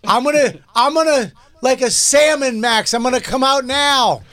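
high, echoing voice from inside a tube; through a tube. Retold or imitated. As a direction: call out from inside a water slide. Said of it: "my tube voice".

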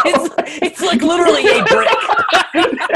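high, slow laughing. Real, not acted. Laughing at the guess.

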